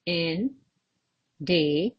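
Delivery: isolated syllables with long pauses; teacher sounding out syllables. Shown as a demonstration. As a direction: count the word out in beats. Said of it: "How many syllables are there?".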